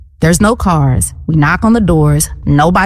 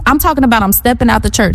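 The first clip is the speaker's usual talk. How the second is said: ordinary delivery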